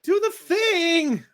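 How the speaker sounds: put-on high, slow, drawn-out child's demand; a small girl calling from the bushes, demanding; little girl insisting. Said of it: "she calls back".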